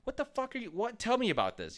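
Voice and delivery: high, shocked what, then eager; stunned disbelief. Retold or imitated. Bored student suddenly hooked by a history fact, shocked and eager. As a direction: jolt up in disbelief, then lean in. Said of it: "I'd be like".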